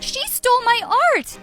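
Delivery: loud, high cry with STOLE hit hard; furious accusation. Acted. Daughter denouncing her mother to the reporters, furious. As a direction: turn to the crowd and denounce her.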